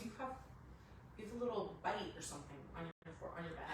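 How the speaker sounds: quiet calm. Retold or imitated. Husband quietly tells her it is only a bite. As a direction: point it out gently.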